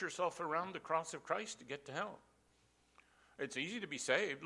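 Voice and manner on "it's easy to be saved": ordinary talk, nothing marked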